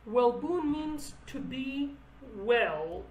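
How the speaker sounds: ordinary delivery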